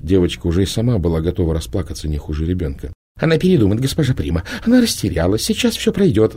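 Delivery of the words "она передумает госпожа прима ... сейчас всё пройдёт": high, hurried coaxing voice; smooth things over quickly; soothing Proxima. A narrator's reading. Proxima reassures Prima about the girl, high and coaxing. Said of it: "заговорила Проксима".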